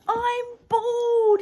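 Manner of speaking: high, loud horrified scream. Acted. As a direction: shriek it in horror. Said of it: "he screamed".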